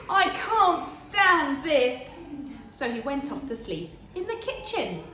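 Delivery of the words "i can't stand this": slow, higher, louder weary complaint; fed-up Mister Bear. Acted. Mister Bear, kept awake, moans slowly and loudly. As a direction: drag it out, fed up and sleepless. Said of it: "said Mister Bear".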